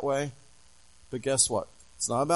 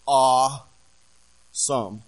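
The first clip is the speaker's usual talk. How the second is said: awesome stretched very slow and louder; drawn-out awed awesome; 6 dB louder, much slower than usual. Shown as a demonstration. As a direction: let awesome linger in awe, not teen slang. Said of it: "not like a teenager uses that word".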